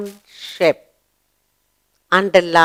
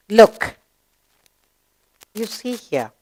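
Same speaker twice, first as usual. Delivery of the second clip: ordinary talk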